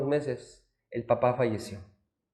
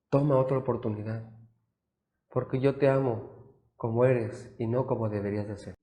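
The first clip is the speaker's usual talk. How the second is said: tender father's letter; 9 dB quieter than usual. Acted. Dead father's letter read aloud.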